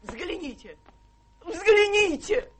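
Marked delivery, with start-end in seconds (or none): 1.4-2.5 loud, slow, accusing cry; drawn-out indignant outcry; dramatic exclamation. Acted. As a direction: point and exclaim it.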